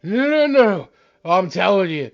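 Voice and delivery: put-on loud insistent drunk; town drunk. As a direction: protest like a drunk.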